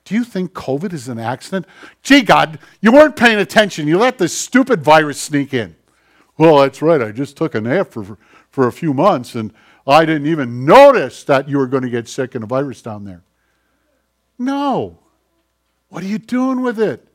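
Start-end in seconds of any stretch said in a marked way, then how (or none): 2.0-5.8 loud, higher mock rebuke of God; sarcastic scolding. Preacher mocking people who blame God, loud sarcasm. 6.4-13.2 loud, dry sarcastic God voice; mock sarcastic reply. Preacher voicing God answering the accusers, mock sarcasm.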